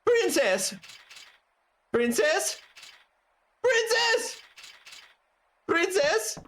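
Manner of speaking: high, comic repeated calls; calling out. Acted. Knight searching for the missing princess, calling out high. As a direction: keep calling for her in a high-pitched voice.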